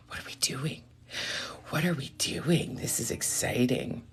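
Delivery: gasp, then hushed whispering; excited whisper. Real, not acted. Reader awaiting Diane's choice of reading, excited whisper. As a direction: whisper it in breath-held anticipation.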